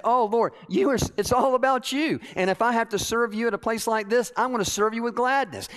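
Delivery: high, loud impassioned prayer; fervent declaration. Retold or imitated. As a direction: cry out your devotion with conviction.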